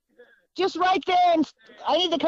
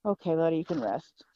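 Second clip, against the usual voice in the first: soothing; 9 semitones lower, 7 dB quieter than usual. Real, not acted. Soothing the tired horse.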